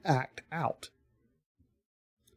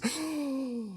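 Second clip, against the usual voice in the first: shocked gasp; 10 semitones higher, 10 dB quieter than usual. Retold or imitated. Woman shocked by outburst.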